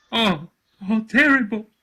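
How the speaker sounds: high, loud gasped distress; gasping, out of voice. A narrator's reading. Panting Caruso reporting to the boss, gasping. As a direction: gasp it out as if the voice has gone. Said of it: "gasped Caruso, exceptionally out of voice".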